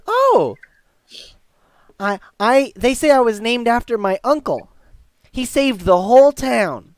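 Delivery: put-on high, loud little-boy voice; proud young boy. Boy telling stranger about his namesake, proud and young. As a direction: show off like a proud kid.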